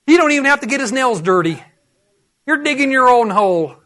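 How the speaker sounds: ordinary delivery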